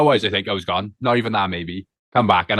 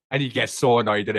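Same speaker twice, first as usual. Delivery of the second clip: ordinary talk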